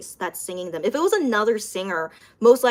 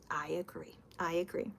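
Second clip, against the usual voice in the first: soft breathy whisper of I agree; whispered agreement; 6 semitones lower, 17 dB quieter than usual. Real, not acted. To viewer Joey, agreeing in a hushed whisper. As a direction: drop to a whisper, nodding along.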